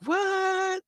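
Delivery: shrill high squeak of disbelief; surprised. Retold or imitated. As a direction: yelp it in shock. Soldier stunned by new feed.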